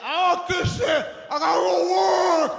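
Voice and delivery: put-on father shouting to mother about the baby; father yelling. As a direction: yell it like a dad rushing out the door. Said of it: "Do you wanna kiss the ba-?".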